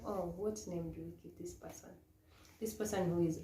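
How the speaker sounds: normal talking voice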